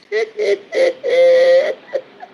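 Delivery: loud, high laugh; high cackling laugh. Real, not acted. Laughing at her own story, high cackle. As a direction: burst out laughing.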